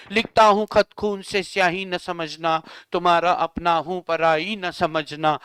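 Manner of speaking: slow, low recitation; mock love verse. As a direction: recite corny letter poetry, unhurried. (शायराना अंदाज़ में, धीरे)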